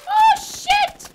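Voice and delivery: very high, loud yelp; real shocked scream; startled shout. Real, not acted. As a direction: yell it in sudden alarm. Suddenly hit in the game.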